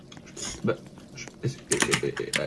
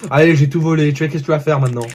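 ordinary talk

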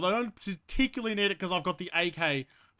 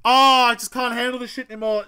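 loud, higher exasperated complaint; fed up; 4 semitones higher, 7 dB louder than usual. Real, not acted. Player quitting a game he can't handle, fed up. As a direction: give up with a loud groan. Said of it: "Oh, I've had enough".

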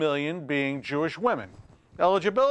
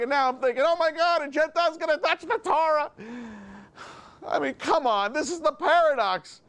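exasperated self-mockery; 7 semitones higher than usual. Real, not acted.

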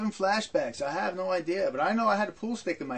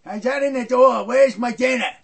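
mock yelling; 4 semitones higher, 9 dB louder than usual. Father demands dinner on arriving.

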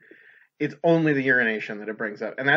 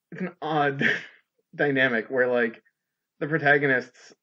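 normal talking voice

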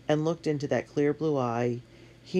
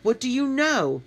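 loud, much higher demand; snapped; 11 semitones higher, 5 dB louder than usual. A narrator's reading. Matt demands Katie's secret, snapping. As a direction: snap the question at her. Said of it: "Matt snapped".

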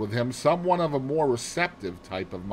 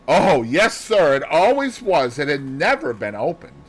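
put-on louder, emphatic servant girl; earnest maid, raised voice; maid Annie answering; 5 semitones higher, 7 dB louder than usual. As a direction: answer the detective eagerly as a maid.

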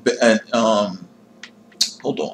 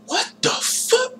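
a high, shocked yelp of disbelief; startled squeal; 8 dB quieter, slower than usual. Real, not acted. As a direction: squeak the words out, caught off guard. Game bugs out again.

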